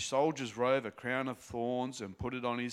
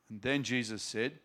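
ordinary talk, nothing marked

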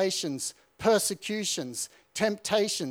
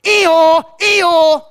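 high, loud mocking donkey bray; 10 semitones higher, 15 dB louder than usual. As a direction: bray like a donkey to taunt him. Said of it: "he starts baying at me like a donkey".

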